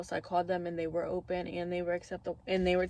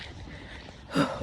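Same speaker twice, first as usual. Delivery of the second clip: cold shaky exhale; 4 dB quieter than usual. Real, not acted. Freezing outside.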